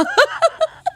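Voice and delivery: real very high laugh. Real, not acted. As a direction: burst into laughter.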